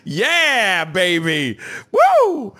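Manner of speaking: loud, high, hammed-up cheer; playful high holler, drawn out; mock-excited whoop. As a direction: whoop it up like a showman. Clowning with accents, whooping.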